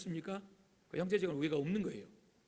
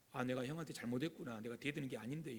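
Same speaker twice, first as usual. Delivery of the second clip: lower, softer acted regret; sincere remorse; 5 semitones lower, 7 dB quieter than usual. Younger brother realizing he wronged his older brother, quiet remorse. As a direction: own up quietly, as if to yourself. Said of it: "이게 진짜 용서라는 거예요".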